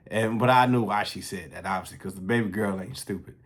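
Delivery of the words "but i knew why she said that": ordinary talk, nothing marked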